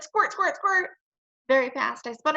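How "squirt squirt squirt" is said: playful squirt sound. A narrator's reading. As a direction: play at spraying seltzer. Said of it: "I said".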